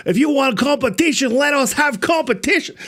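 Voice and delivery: high-pitched, hammed-up movie gangster; Scarface impression. Host demonstrating how he heard the comment, bad Scarface impression. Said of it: "It's either like a Scarface thing".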